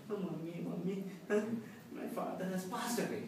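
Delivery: mock sobbing little boy; inconsolable child. Crying boy telling mommy of a death, sobbing. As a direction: whimper it out between put-on sobs. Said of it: "was still inconsolable".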